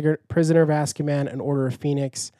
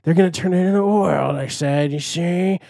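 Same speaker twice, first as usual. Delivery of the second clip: higher put-on villain voice relishing the oil; oil baron from The Muppets gloating about oil, higher put-on voice; 4 semitones higher, slower than usual. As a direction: gloat over the sweet oil like a cartoon villain. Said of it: "And he's like".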